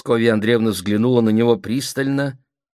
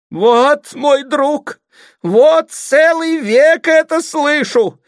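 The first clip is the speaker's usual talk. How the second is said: very high, loud, drawn-out complaint; wailing outcry; 9 dB louder, much slower than usual. A narrator's reading. Mother complaining to her family, wailing outcry. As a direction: cry out at the end of patience. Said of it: "вскричала Любовь Сергеевна".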